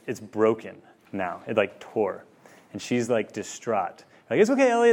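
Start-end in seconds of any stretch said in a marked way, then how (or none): 4.4-4.9 higher and louder, coaxing; soothing dad. Retold or imitated. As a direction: reassure a wailing toddler. Dad soothing upset daughter.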